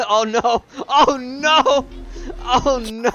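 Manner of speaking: laughing. Real, not acted. Reacting to a clip, laughing.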